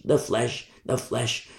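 ordinary talk

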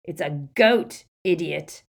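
loud, slow, scornful correction; exasperated, emphatic shout; roaring. A narrator's reading. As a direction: roar it as to a fool. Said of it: "roared the barman".

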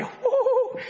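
high, hammed-up woo-hoo; cartoonish cheer to stir the crowd; mock excited cheer. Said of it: "somebody get excited here".

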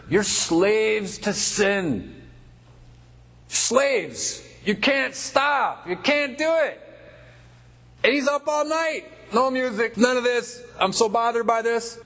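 high, drawn-out preacher's cry; impassioned preaching. Acted. Preacher to the unconverted about slavery to sin, high impassioned cry. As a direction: stretch the words out high and urgent.

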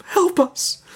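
put-on high, plaintive little-girl plea; pleading cry. A narrator's reading. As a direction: cry for help as a frightened girl. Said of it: "she cried".

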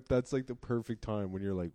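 usual speaking voice

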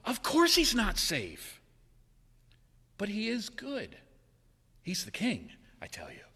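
put-on an old church lady setting them straight; Mrs Beaver. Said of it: "Missus Beaver replied".

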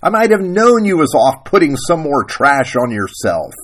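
mildly heated wifely reproach; growled scolding. Acted. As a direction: scold him like a fed-up wife. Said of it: "Sarah Ruth growled".

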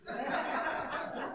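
short high-pitched laugh. Real, not acted.

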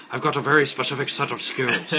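a light Liam Neeson voice; lightly voiced tough guy; Liam Neeson impression. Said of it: "he's like, ... is how he sounds".

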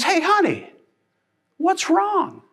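husband walking into the kitchen, innocent mock; clueless husband. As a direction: ask it sweetly and cluelessly. Clueless husband asks wife.

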